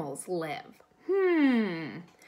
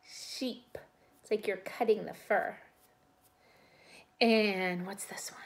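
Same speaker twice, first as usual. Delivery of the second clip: ordinary talk, nothing marked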